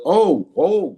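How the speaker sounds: sudden loud high oh oh; loud interruption. Real, not acted. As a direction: cut in loudly. Interrupting co-host loudly.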